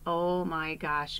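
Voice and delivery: usual speaking voice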